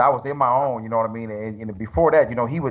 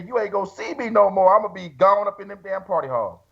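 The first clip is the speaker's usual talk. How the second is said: laughing refusal; 6 semitones higher than usual. Retold or imitated. Refusing Chris's tour, laughing at himself.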